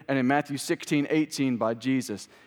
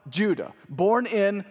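emphatic, raised preaching delivery; 3 semitones higher than usual. Acted. Preacher proclaiming Christ's victory to the church, emphatic preaching. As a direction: preach it in weighty phrases with pauses.

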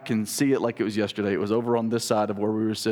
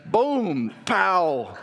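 higher punchy boom pow; mock gunshot sounds; 5 semitones higher than usual. Retelling the rifle hitting his nose, as punchy sound effects. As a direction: punch out comic-book gun noises.

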